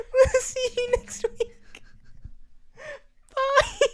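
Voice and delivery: very high, drawn-out farewell; squeaky sign-off. Acted. Host signing off till next week, comically high and slow. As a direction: stretch it out in a high, strained squeal.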